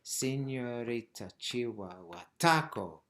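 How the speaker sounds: slow rhythmic count, hit "taco" hard; emphasis on the beat. Shown as a demonstration. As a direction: land firmly on "taco". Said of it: "keep the emphasis on the foot".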